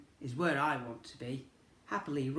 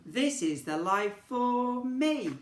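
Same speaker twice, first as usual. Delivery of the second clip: high, slow joyful finish; happy refrain; 9 semitones higher, 4 dB louder, slower than usual. Acted. Racehorse singing its happy refrain, high and slow. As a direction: stretch it out high and glad.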